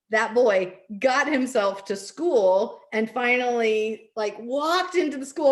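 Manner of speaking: choked-up pride. Real, not acted. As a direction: let the pride break through with tears. Proud mother recalling son's return.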